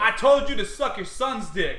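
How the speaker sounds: light New York accent demo. Shown as a demonstration. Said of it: "is, like, how I said it".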